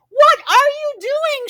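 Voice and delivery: high, loud alarmed shout. A narrator's reading. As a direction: yell it out in alarm. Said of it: "shouted Arnie".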